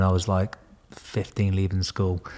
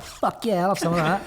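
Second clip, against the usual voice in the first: put-on much higher, thrilled teenage yes; eager; 10 semitones higher than usual. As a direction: sell it like a kid who's just been won over. Hooked by helicopter video.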